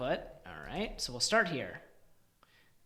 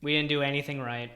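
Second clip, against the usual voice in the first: normal talking voice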